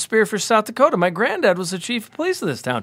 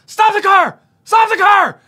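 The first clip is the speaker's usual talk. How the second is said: much higher and louder, yell it at the driver; frantic shout to the cab driver to stop; urgent shout; 7 dB louder than usual. Retold or imitated. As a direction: slam the brakes, call it out in alarm. Spotting friend, yelling at cab.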